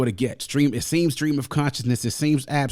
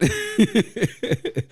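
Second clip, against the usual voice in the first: delighted laugh; 13 semitones higher, 3 dB louder than usual. Real, not acted. Laughing at the lyric.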